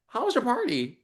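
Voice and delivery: high, sugary mock question; sweetly ask, faking innocence. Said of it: "looked at her and said".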